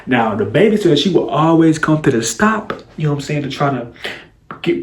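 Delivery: ordinary delivery, nothing marked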